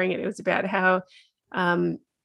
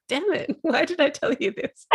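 laughing mock dismay; 8 semitones higher than usual. Mock-curses her daughter's advice.

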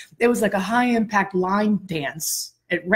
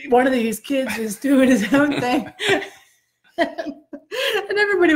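giggly, pitched up; laughing while talking; 6 semitones higher than usual. Real, not acted. Whole class turns to her, laughing while telling it. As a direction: laugh your way through it.

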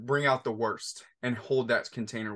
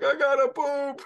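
put-on very high, louder little-boy whine; whiny child; 4 dB louder than usual. Second son needing the bathroom, high mock-whiny kid. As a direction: squeak it like a desperate kid. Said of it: "he was crying because he had to poop".

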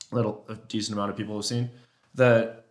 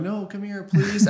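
much higher, begging whine; whiny cow call voice; 10 semitones higher, 4 dB louder, slower than usual. Retold or imitated. Hunter whining cow talk at the bull, high and pleading. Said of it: "just whining at him".

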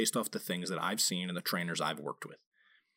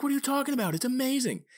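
much higher, mock-offended friend; indignant friend; 4 dB louder, faster than usual. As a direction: defend your favourite flavour in outrage. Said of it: "They're like".

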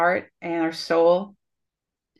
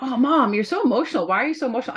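higher, mock-whiny imitation of the kids; teasing kids; 5 semitones higher, faster than usual. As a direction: tease Mom the way her kids do. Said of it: "and they're like".

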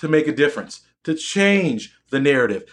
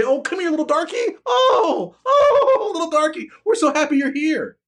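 high, hammed-up sugary mock welcome with a shrieked oh; sneering parody of fake embrace, squealed high; mocking patronizing voice; 11 semitones higher than usual. Mocking those who pretend to welcome him, in a shrill fake-sweet voice. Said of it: "embrace you and say".

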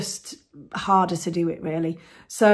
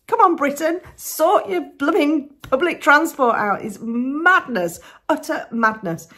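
higher, louder, drawn-out exasperation; exasperated rant; 8 semitones higher, 5 dB louder than usual. Real, not acted. Podcaster ranting about blooming public transport, exasperated. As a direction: lean hard on the words in disbelief. Mock rant at train prices.

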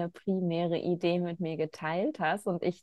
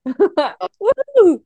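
loud high laughing whoop; joyful cheer; 11 semitones higher, 11 dB louder than usual. Real, not acted. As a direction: whoop with delight. Celebrating end of interview.

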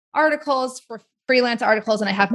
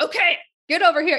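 half-hearted dad voice, pitched high; attempted dad voice; 8 semitones higher than usual. Nice person trying on the coach's order, mock dad voice. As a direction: have a go at a commanding dad voice. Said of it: "Use your dad voice".

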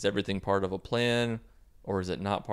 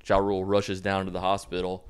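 usual speaking voice

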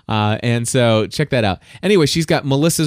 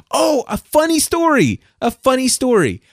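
sudden excitement; 12 semitones higher than usual. Real, not acted. Suddenly remembers a story.

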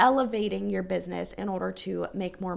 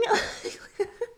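teary laugh; 11 semitones higher than usual. Real, not acted. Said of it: "I was just blubbering".

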